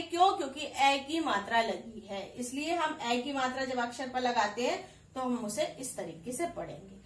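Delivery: ordinary talk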